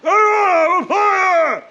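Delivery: high, loud shouting for help; yelling out through a broken jaw; retold shout. Man with a broken jaw calling out, loud shout. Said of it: "I was trying to shout".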